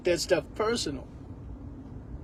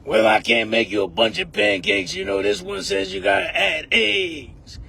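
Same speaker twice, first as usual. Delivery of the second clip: deep gruff grandma; 3 semitones lower than usual. Retold or imitated. Mocking the grandma who has one egg, deep gruff voice. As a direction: boss him like a bossy old grandma. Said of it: "a voice like that".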